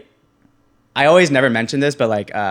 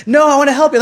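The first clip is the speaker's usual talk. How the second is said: much higher and louder, pleading insistence; more insistent stranger; 8 dB louder, faster than usual. Retold or imitated. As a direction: push even harder, almost begging. Stranger insists again, harder.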